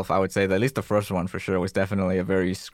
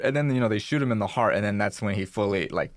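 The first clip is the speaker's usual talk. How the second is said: usual speaking voice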